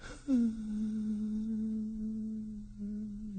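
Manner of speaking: long, high, tiny sigh; small high whimpering sigh; deep little sigh. A narrator's reading. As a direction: let out a faint drawn-out sigh. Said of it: "The little voice sighed deeply".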